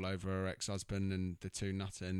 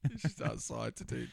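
normal talking voice

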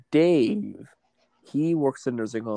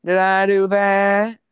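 loud, high, drawn-out catchphrase; Steve Urkel impression; 7 semitones higher, 8 dB louder, much slower than usual. Co-host playing off a stage mishap, Steve Urkel impression. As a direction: stretch it out high and loud, mock-innocent. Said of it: "good Steve Urkel impression".

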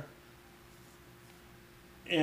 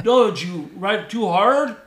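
loud, slow, high mock Mexican accent; Mexican captain accent; 5 semitones higher, 8 dB louder, slower than usual. Captain questioning the rider, dismayed Mexican accent.

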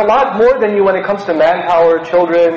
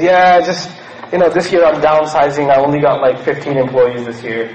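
smug understatement; 7 semitones lower than usual. Retold or imitated. Boss brags about downsizing.

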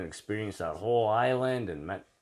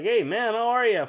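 high, loud retold buddy hello; excited greeting; 13 semitones higher, 6 dB louder than usual. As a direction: imitate a pal hailing you, pitched way up. Jerry's beach greeting, retold.

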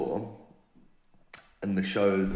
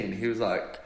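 ordinary delivery

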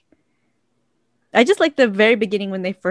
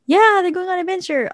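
high-pitched replayed excitement; joyful high swoop on "Yeah"; mock cheering; 11 semitones higher than usual. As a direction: cheer along as if watching it happen.

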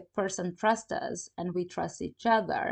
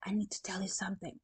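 fast, softer secretive whisper; hushed confidential aside; 9 dB quieter, faster than usual. Retold or imitated. Neighbour sharing a secret.

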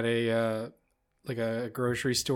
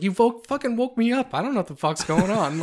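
much higher, mock-aggrieved outburst; indignant complaint; 10 semitones higher than usual. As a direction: whine in protest at being woken, pitched right up. Retold outburst at the wrong-number caller.

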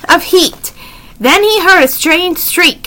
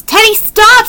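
high, urgent call to stop; urgent plea; 8 semitones higher than usual. A narrator's reading. Jack calls to Teddy as he waves the twig, urgent. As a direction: call out to halt him, pitched up.

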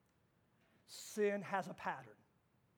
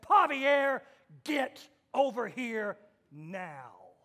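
stern mom command; 10 dB louder, much slower than usual. Retold or imitated. Mom commanding the dog as she did her boys, stern and slow. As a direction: order the dog like a teen son. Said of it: "like she treated her teenage boys".

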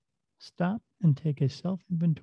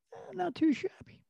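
high, odd put-on voice for "not too shabby"; quirky stranger voice; 6 dB quieter than usual. A stranger answering a store greeting, oddly high and quirky. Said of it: "stranger's odd reply".

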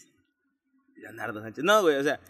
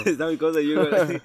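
laughing; 3 semitones higher, 8 dB louder than usual. Real, not acted. Laughs at friend's spicy reaction.

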